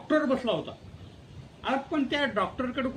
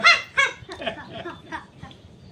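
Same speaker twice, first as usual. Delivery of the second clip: hearty laugh; 8 semitones higher, 7 dB louder than usual. Real, not acted. Teller laughs at his punchline.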